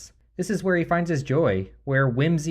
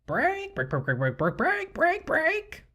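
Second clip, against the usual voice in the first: much higher, clucking chicken squawks; chicken impersonation; slower than usual. Pretend. Host clowning for listeners with a high squawking chicken voice. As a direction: cluck it out like a hen. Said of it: "uh, chicken impersonation".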